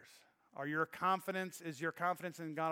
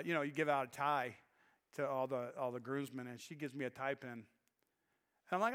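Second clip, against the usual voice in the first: ordinary talk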